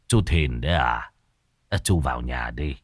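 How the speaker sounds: low, soft, weary greeting; dejected. A narrator's reading. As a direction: sound beaten down and tired. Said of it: "Nghe giọng nói thiểu não". (ông lão buồn bã chào hàng xóm)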